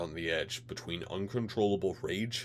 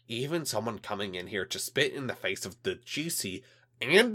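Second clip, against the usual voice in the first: loud, outraged alien official; angry alien official; 5 semitones higher, 7 dB louder than usual. Acted. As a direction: berate him like a furious bureaucrat. Alien liaison rants.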